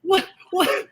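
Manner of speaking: high, laughing what; shocked disbelief. Real, not acted. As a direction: squeal it out in disbelief. Shocked at millennial remark.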